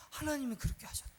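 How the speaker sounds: quick whispered emphasis; hushed revelation. Real, not acted. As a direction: drop to a hush for the reveal. Preacher drops to hushed revelation.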